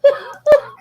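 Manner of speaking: high, loud cackling laugh; real laughing fit; burst of laughter. Real, not acted. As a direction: crack up out loud. Breaks up laughing on call.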